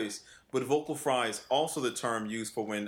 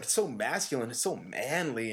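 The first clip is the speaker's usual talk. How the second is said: mocking student. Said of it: "his response, it was so visceral".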